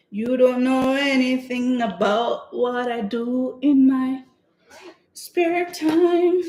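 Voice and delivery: slow, drawn-out singsong comeback, climbing high at the end; mock indignant retort. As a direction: stretch it out in playful mock offence. Mock retort to the store guy.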